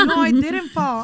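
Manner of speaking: laughing while protesting loudly; real laughter breaking through the denial. Real, not acted. Mother laughing while denying she fell on the speed bump.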